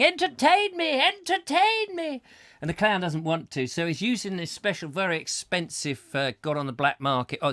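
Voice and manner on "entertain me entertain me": high, drawn-out mocking chant; demanding audience. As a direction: whine it like a bored crowd demanding a show. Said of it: "audience out there waiting, saying".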